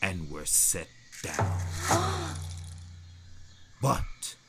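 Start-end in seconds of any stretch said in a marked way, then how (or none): 1.6-2.8 sharp high gasp of shock. Acted. Sisters gasp as the bow appears, high and sharp.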